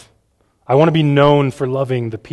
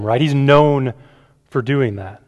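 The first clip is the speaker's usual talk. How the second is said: ordinary delivery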